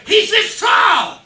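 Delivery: high, slow shout of disbelief; hammed-up stunned holler; shocked. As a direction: yell it in comic outrage. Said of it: "And so I was shocked".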